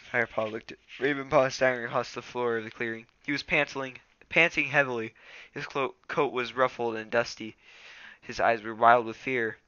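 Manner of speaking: ordinary delivery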